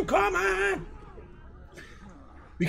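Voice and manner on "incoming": alarm shout. Acted. Alarm in a sudden fight.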